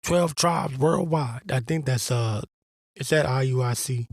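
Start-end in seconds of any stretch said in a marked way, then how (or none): 0.0-1.5 mock their chest-bumping brag, dragging the last words; mocking braggart. Mocking the Israelite groups who brag about size, in a lightly smug voice. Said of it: "just so they can bump their chest".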